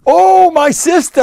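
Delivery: high, drawn-out exclamation of greeting; acted joyful astonishment; delighted surprise. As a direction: greet a long-lost sister in amazement. Acted greeting of long-unseen sister.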